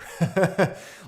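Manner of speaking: loud, high burst of laughter; short, real bright laugh. Real, not acted. Laughs at his own joke.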